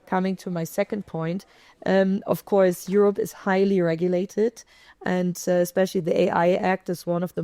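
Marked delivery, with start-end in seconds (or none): none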